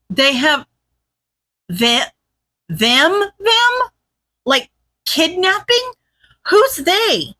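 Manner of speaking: high, incredulous questioning as it sinks in; shocked disbelief. Acted. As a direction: echo "them" haltingly, rising high into a question. Stunned echo of the cryptic news.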